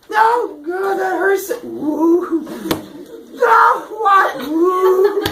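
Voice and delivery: high, loud mock howls of pain; pained wailing. Epimetheus slapping himself over and over, comic pained wailing. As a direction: yelp like a dim victim, baffled and hurting. Acts Epimetheus hurt in slap game.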